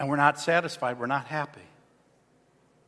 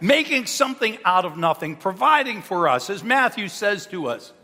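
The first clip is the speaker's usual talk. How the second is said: usual speaking voice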